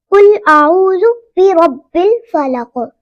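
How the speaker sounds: slow, measured tajweed recitation; Quran recitation. Shown as a demonstration. Teacher reciting a Quran verse to students, slow and measured. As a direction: recite each sound carefully. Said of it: "कुल को कुल नहीं पढ़ेंगे".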